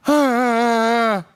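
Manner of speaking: loud, high cry of dismay; exasperated cry. Retold or imitated. Finding his other car's dash separated too, loud dismayed cry. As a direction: let out a pained groan at yet another broken dash. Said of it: "I'm like".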